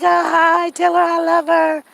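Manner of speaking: high, loud, syrupy phone greeting; sugary mother-in-law. Retold or imitated. Mother-in-law gushing hello to her son's wife. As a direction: put on her over-sweet phone voice.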